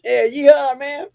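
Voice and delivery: playful, hammed-up Southern drawl. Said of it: "redneck drawl".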